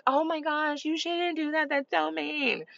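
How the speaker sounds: high, soft mock-horrified parent; high-pitched mock dismay; judgmental parent. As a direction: imitate a scandalized parent, high and hushed. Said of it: "some parents be like".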